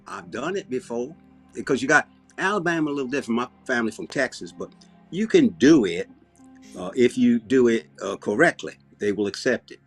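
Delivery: down-home Southern talk; Southern accent. Pretend. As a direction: explain it in an easygoing Southern accent. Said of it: "it's kind of the way I talk when I'm down here".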